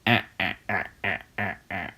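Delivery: low fading villain cackle; goblin cackle. Acted. Green Goblin cackling after throwing pumpkin bombs. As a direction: chuckle wickedly, dying away. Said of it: "Green Goblin".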